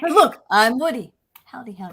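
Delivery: Woody. Acted. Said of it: "You trying to sound like Woody?".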